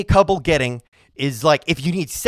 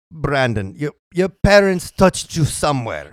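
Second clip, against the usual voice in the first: slightly put-on German therapist; a bad Freud impression in German; German accent; 5 semitones lower, slower than usual. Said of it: "That's my German accent".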